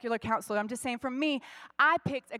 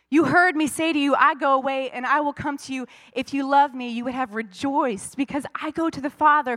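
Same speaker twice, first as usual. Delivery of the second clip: louder, proclaiming the verse; loud preaching; 7 dB louder than usual. Acted. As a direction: call it out to the church.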